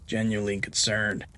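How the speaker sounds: ordinary delivery, nothing marked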